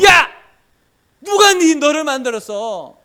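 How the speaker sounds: high, loud furious shout; angry God. Acted. God angrily shouting at stubborn Moses, furious. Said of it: "하나님 화를 내십니다".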